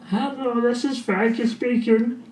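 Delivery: patient switching to a put-on high voice to play Frankie; alter personality voice.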